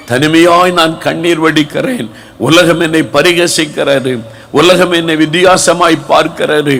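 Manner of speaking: fervent sermon, pressing on the listeners; impassioned preaching. Acted. Preacher pleading with the hurting congregation, fervent.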